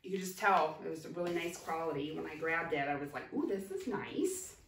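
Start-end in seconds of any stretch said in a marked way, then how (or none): none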